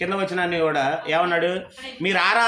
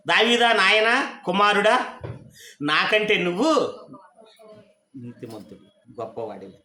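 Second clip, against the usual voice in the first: a king humbled, calling David his son, dying away; preacher voicing Saul tenderly to David, slowing and softening; tender fatherly plea. Saul moved, calls David son.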